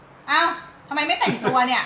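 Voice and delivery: loud, high-pitched scolding; nagging spouse. Acted. Interpreter mimics a spouse nagging about getting dressed, loud scolding.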